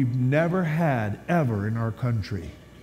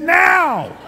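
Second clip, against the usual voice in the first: much higher and louder, laughing; urgent shout; 12 semitones higher, 8 dB louder than usual. Retold or imitated. Pastor demanding baptism right now, high, loud and laughing. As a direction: bellow the answer, urgent.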